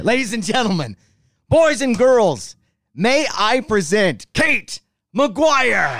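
slow, high and loud announcer intro; grand entrance announcement. Pretend. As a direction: stretch it out like a ringmaster. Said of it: "that was, like, way better".

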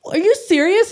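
put-on high astonishment, rising at the end; mock disbelief. Students falling for the joke.

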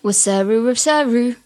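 wasaru said as a reversed sound; imitating reversed speech. Shown as a demonstration. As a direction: mimic the reversed clip as wasaru. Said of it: "I need your reversal rehearsal".

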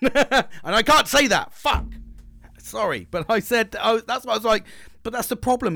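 cracking up at his own rant; laughing. Real, not acted.

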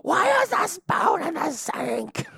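slow, high, loud wail; drawn-out, hammed-up cry of exasperation; exasperated mock yell. Confronting a housemate about the spoon, exasperated mock yell.

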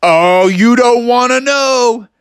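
high, drawn-out boast; cocky hero. Acted. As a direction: stretch it into a heroic threat. Froggy answers ogre's taunt.

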